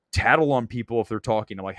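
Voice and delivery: ordinary talk, nothing marked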